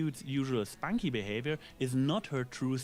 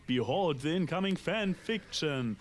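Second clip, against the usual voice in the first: mock ominous; 5 semitones higher than usual.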